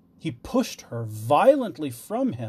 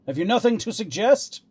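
raised, louder, impatient question; 8 semitones higher, 5 dB louder than usual. A narrator's reading. King wants a suggestion from jester, impatient. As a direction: press for an answer impatiently. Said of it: "the king said impatiently".

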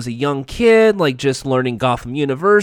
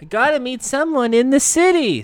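high, put-on young hero voice; Jason; 11 semitones higher than usual. Jason brushing off Bruce, high put-on voice. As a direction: pitch it up for the sidekick. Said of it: "says Jason".

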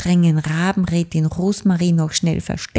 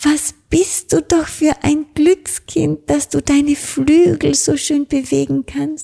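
calling out; 10 semitones higher than usual. A narrator's reading. Little witch calls out praise to the blackbird. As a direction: cheer him on warmly from afar. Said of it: "Hexe Dorothee plötzlich rufen".

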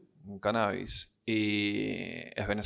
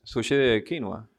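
ordinary talk, nothing marked

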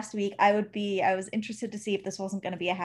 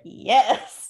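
louder, punchy yes; emphatic retold yes; 6 dB louder than usual. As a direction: answer eagerly, hitting it hard. Retells own eager answer.

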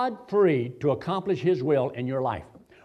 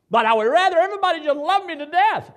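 high mock plea; 11 semitones higher, 5 dB louder than usual. Pleading aside, high and loud.

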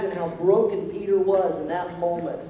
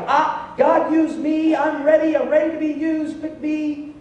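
much higher, louder, keen-as-mustard pleading; eager volunteering; 10 semitones higher, 5 dB louder than usual. Retold or imitated. Pastor voicing Peter begging God to use him, high and eager. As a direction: jump up and beg to be used. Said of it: "Peter was like".

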